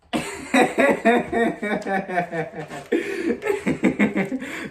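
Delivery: big laugh. Real, not acted. Laughing at the joke.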